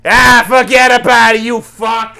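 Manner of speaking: loud, high Boston movie gangster; hammed-up Boston accent. Mocking an actor overdoing a Boston gangster, loud and brash. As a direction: lay the Boston accent on thick. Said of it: "that's what he is in The Departed".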